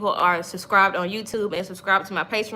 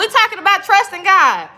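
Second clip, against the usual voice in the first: laughing; 7 semitones higher, 6 dB louder than usual. Real, not acted. Laughs mid-sentence to her audience.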